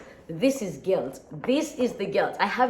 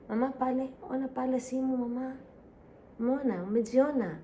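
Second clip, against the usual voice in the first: pet talk; 7 semitones higher than usual. Real, not acted. Cooing Swahili at her pet.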